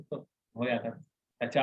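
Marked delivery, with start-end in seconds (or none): 1.5-1.6 Haryanvi tone. Retold or imitated. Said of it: "Haryanvi tone है".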